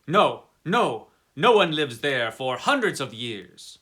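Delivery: loud, hurried no, no; urgent denial. Acted. Coachman insists to the traveller that nobody lives there, loudly.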